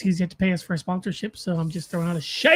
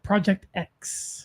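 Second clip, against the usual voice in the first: slow dramatic; 2 semitones lower, 5 dB quieter, much slower than usual. Pretend. Dramatic slow title drop.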